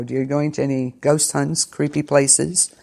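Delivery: ordinary talk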